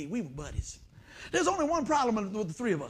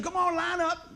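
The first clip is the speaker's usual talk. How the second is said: teacher's order; 8 semitones higher than usual. Retold or imitated. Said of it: "so she said".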